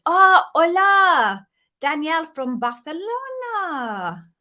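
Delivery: slow, loud and high, "hola" said the Spanish way; Spanish greeting. Shown as a demonstration. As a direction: greet her warmly in your best Spanish. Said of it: "That's about the extent of my Spanish".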